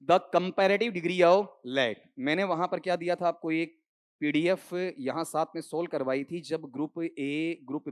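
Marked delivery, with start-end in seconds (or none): 0.0-2.1 slow dictation. Shown as a demonstration. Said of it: "अगले पे आओ".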